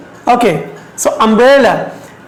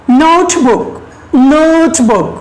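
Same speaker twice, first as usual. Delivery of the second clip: Notebook with the first syllable stretched; stress on NOTE; 3 semitones higher, much slower than usual. Shown as a demonstration. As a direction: lean on "Note" and let "book" fall. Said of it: "the note is first one".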